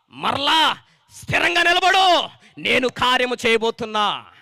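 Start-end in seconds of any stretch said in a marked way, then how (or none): 0.1-2.3 loud, slow command to stand firm; slow exhortation. Acted. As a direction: drive each word home. Preaching exhortation. (బిగ్గరగా నెమ్మదిగా ప్రబోధం)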